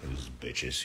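ordinary delivery

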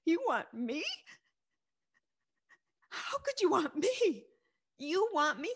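answering God's call in high, stunned disbelief; astonished disbelief. Retold or imitated. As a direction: she can't believe God wants her. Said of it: "And I was like".

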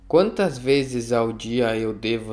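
normal talking voice

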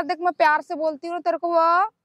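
usual speaking voice

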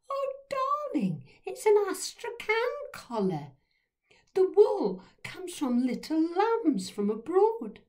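very high, airy posh lady. A narrator's reading. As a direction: explain the collar with grand posh affectation. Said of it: "posh voice".

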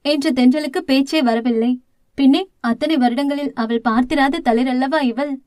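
ordinary delivery, nothing marked

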